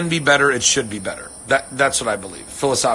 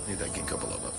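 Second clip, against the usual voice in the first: whispered self-aside, much softer; muttered aside; 9 semitones lower, 17 dB quieter, faster than usual. Real, not acted. Forgetting her topic, muttering to herself. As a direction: breathe it to yourself as a joke.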